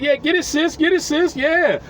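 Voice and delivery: high, excited hype-man cheer. Retold or imitated. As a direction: egg her on, high and excited. Hyping up twerking woman.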